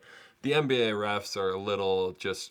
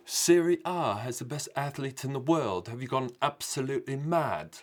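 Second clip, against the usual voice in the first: higher, put-on British accent; mock-indignant British football fan, pitched up; 5 semitones higher than usual. Shown as a demonstration. Showing viewers his best attempt, British accent. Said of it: "British accent. Do, do I sound good".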